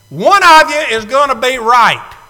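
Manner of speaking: preacher booming the punchline; loud punchline. Real, not acted. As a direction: land the punchline to the crowd.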